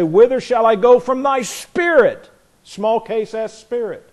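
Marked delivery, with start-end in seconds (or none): 0.0-2.3 psalm quote. Retold or imitated. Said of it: "he's gonna come along there and say".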